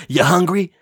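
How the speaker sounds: high, loud blurted question; loud high mock Cage line; Nicolas Cage impression. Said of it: "I love your Nicolas Cage impression".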